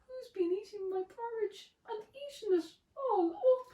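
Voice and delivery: high, fast little bear squeak; tiny squeaky voice. Acted. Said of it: "a tiny, squeaky little voice".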